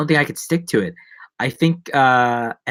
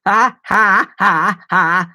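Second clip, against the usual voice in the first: laugh-cry; 6 semitones higher, 6 dB louder, much slower than usual. Real, not acted. Laughing-crying after song.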